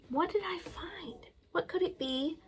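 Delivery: soft, breathy whispered question. Pretend. Librarian whispering the riddle to kids. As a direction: whisper it as a secret guess. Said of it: "whispered guess".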